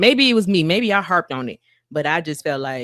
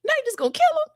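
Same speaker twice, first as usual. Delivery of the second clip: high, earnest plea; pleading Moses. Retold or imitated. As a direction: remind God of his promises urgently.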